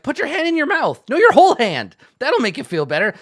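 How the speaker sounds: very high, loud goblin advice; a goblin fussing over a hurt friend; bossy goblin. Retold or imitated. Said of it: "telling the hurt goblin, like".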